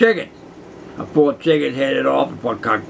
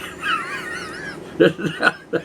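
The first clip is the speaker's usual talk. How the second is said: high laugh; 4 dB quieter than usual. Real, not acted. Laughing at his own jail story, high-pitched giggle.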